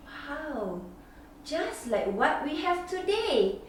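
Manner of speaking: ordinary delivery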